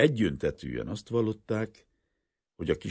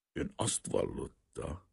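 hostile rasping voice; 7 dB quieter, slower than usual. Acted. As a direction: rasp it out slowly and coldly. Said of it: "recsegő hangon a kihallgató tiszt".

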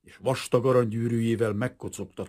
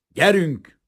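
loud, higher, curt command; curt order; 7 semitones higher, 10 dB louder than usual. A narrator's reading. Detective hauling Kazimír off by the arm, curt. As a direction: order it curtly and forcefully. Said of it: "mondott az egyik detektív kurtán".

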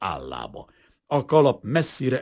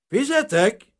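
high, loud one-word shout; calling out; 12 semitones higher, 8 dB louder, slower than usual. A narrator's reading. Grain dealer calling waiters to pay, shouting. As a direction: customer shouting for the bill. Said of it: "kiáltott Aronson".

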